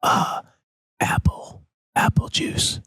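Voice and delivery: put-on hushed child whisper to mom. Imitated daughter whispering the drink order to mom. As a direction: whisper the message conspiratorially.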